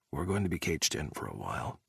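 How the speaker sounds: a soft, low aside to Jack. A narrator's reading. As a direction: say it softly to him. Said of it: "Ethan said softly to Jack".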